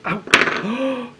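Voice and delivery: sharp high ow; real startled pain; sudden yelp of pain. Real, not acted. Stabbed finger on pins.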